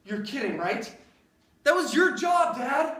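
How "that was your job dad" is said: high, loud exasperated complaint; annoyed outburst. Acted. Son scolding dad about the propane, exasperated. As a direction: blame him loudly.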